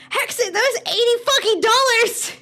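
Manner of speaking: high, loud indignant yell; high loud outraged shout. Real, not acted. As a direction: yell in disbelief at the cost.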